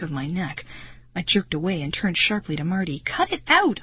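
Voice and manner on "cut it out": sharp angry snap, much higher; snapping. A narrator's reading. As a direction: snap at him in disgust. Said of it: "I snapped".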